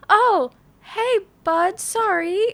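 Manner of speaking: high, drawn-out pet-soothing voice; sheepish cooing apology. Retold or imitated. Owner apologising to the cat just scared, sheepish cooing. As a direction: coax with an awkward singsong apology.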